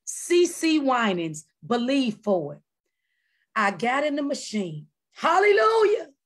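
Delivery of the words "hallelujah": loud, high joyful praise; praise shout. Real, not acted. Testifying to the church, a loud, high praise shout. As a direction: shout it up to God.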